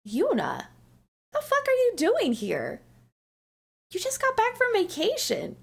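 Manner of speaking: high-pitched mock astonishment; surprised boss. Boss finding employee back at work, imitated surprise. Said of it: "she was so surprised that I was there".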